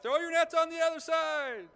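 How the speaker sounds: high, full-force shout carrying over water; shout from shore. Acted. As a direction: call out to fishermen far off, very loud. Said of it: "somebody from the shore shouts out".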